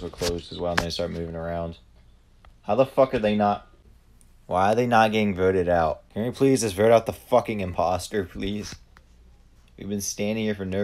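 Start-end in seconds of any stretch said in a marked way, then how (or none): 2.6-8.7 louder, frustrated begging for the vote; exasperated pleading. Real, not acted. Begging his lobby to vote out the imposter, exasperated. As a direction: angrily urge them to finally vote him out.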